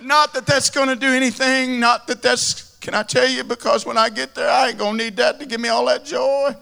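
high, loud, ringing sermon climax; impassioned revival preaching; preaching at full force. Real, not acted. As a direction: preach it with rising fervor to the crowd.

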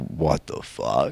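low, drawn-out mock disbelief; imitated bewilderment. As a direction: imitate a stunned younger coworker. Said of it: "he was like".

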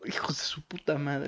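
breathy whispered swearing; whispered curse. Real, not acted. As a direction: curse it under your breath. Maldice en voz baja por el ruido. (maldición susurrada)

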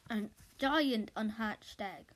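usual speaking voice

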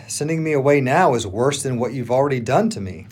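usual speaking voice

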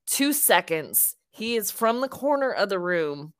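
ordinary talk

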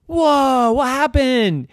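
high, astonished reaction; startled mother. Acted. Mommy finding gum popped all over, startled. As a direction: react high in sudden surprise.

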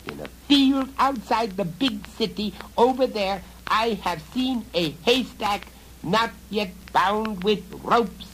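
high, loud goblin orders; frantic goblin commanding. Acted. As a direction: cast it like a goblin spell. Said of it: "she screamed".

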